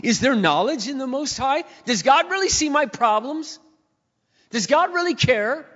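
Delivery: high, loud pleading questions of a doubter; complaining believer asking if God cares, pleading high and loud; doubting believer. Retold or imitated. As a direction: voice the doubting crowd, pleading upward. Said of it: "And they say".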